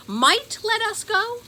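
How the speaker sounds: high, loud disbelieving question; incredulous. A narrator's reading. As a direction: throw her words back in disbelief. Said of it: "said Ben".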